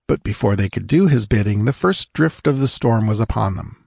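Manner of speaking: plain reading voice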